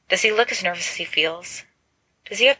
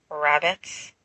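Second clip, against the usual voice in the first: doubtfully; 2 semitones lower than usual. Acted. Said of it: "James says doubtfully".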